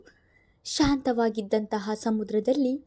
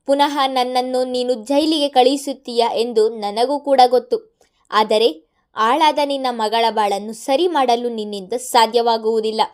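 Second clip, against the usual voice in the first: louder, vengeful villain gloating; 2 semitones higher, 9 dB louder than usual. A narrator's reading. Madan gloating to Mithun over his revenge, loud. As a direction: savour the revenge.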